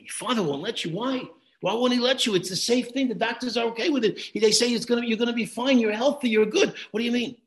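urgent disbelief. Retold or imitated. Father argues with donor on phone.

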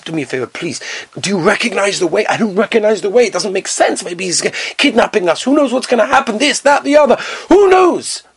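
nervous passenger spiralling into fear; rising panic. Acted. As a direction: let the worry build until it breaks.

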